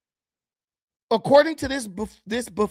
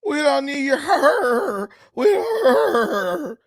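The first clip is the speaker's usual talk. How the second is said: lightly put-on Southern drawl, higher and slow, breaking into a laugh; mock Southern accent; 5 semitones higher, 4 dB louder, much slower than usual. Mocking a man's fake Southern accent to viewers, laughing. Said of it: "that's not no damn Southern accent".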